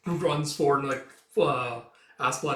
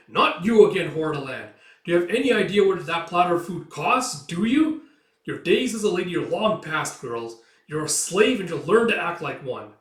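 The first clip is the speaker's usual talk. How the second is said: loud, raised berating; angry scolding; 5 semitones higher, 7 dB louder than usual. Acted. Master to slave girl over spilt food, angry scolding. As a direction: put the servant harshly in place.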